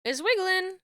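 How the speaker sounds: high, delighted squeal; excited squeal. Real, not acted. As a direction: squeal it with glee. Excited about the wiggling cloak.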